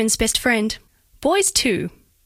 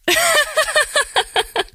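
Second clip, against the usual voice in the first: high, falling peal of laughter; delighted laugh. Acted. Laughs at calling him crazy.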